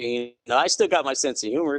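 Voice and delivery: normal talking voice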